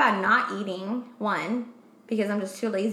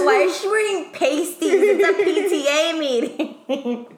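high, loud scandalised gasp, laughing; judgy onlooker; 7 semitones higher, 5 dB louder, slower than usual. Retold or imitated. Parents at a meeting gossiping, high and scandalised. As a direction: clutch your pearls at the meeting. Imagined parents judging her pasties.

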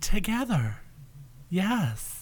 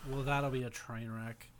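ordinary talk, nothing marked

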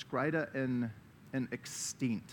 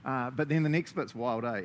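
ordinary talk, nothing marked

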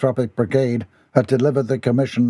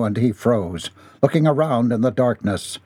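plain reading voice

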